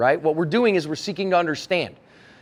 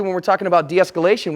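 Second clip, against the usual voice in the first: ordinary delivery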